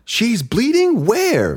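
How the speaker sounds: put-on high, slow, alarmed girl voice; imitating a startled little daughter; alarmed child. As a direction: pitch it way up like a scared kid.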